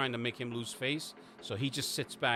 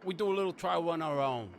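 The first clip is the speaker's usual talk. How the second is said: dismissive; 6 semitones higher than usual. Acted. Boss brushing off correction, louder.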